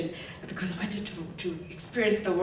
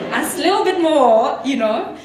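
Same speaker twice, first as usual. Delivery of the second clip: louder, higher, through laughter; laughing cheeky quote; 5 semitones higher, 11 dB louder than usual. Retold or imitated. Her own thought while negotiating, cheeky and laughing. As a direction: laugh as you push your luck.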